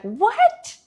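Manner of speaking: a high, loud, startled "What?"; shocked what. Retold or imitated. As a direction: jump up in disbelief on "What?". Therapist shocked at her claim.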